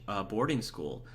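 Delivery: ordinary talk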